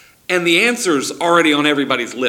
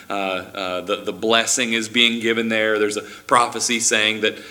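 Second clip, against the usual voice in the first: usual speaking voice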